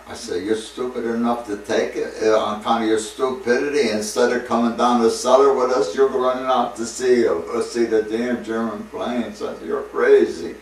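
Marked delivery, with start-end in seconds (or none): 0.4-9.4 loud, higher telling-off of a fool; half-amused angry scolding; scolding buddy. Retold or imitated. 9.7-10.5 loud, higher telling-off of a fool; half-amused angry scolding; scolding buddy. Retold or imitated.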